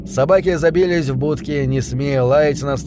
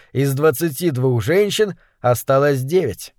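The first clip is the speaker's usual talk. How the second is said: ordinary delivery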